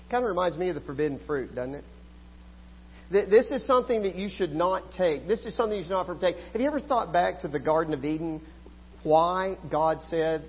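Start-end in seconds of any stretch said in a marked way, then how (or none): none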